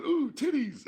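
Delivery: delighted yelp. Retold or imitated. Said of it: "he's going".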